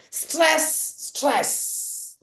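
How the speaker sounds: "Stress, stress" as two drawn-out, separate beats; first "Stress" pitched high, second falling; two equal stresses. Shown as a demonstration. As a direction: give each "stress" its own long hissing beat. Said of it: "To stress".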